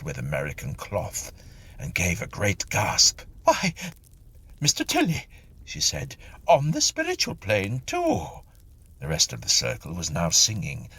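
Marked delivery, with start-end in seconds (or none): 3.4-8.3 gasping surprise at seeing him; startled exclamation. Acted. Medium startled to see Mister Tilly's ghost, high surprised cry.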